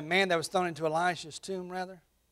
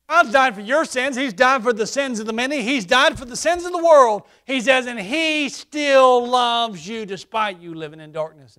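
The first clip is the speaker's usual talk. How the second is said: raised preaching voice, higher and louder; 6 semitones higher, 9 dB louder than usual. Acted. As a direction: drive the gospel home from the pulpit.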